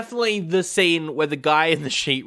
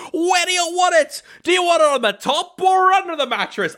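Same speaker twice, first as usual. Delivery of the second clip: much higher, flustered squeak; panicky high Costello; 13 semitones higher, 4 dB louder than usual. Retold or imitated. Retelling the bed scene, Costello's squeaky freak-out. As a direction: play him high and frazzled, losing it. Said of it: "And he's like".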